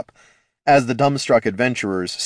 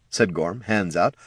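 usual speaking voice

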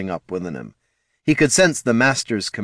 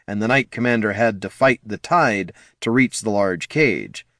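usual speaking voice

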